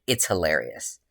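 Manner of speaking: ordinary delivery, nothing marked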